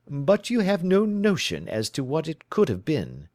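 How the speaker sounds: lightly voiced, crisp questioning detective; brisk detective. Holmes questioning his client, crisply. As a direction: probe her story, brisk and in command.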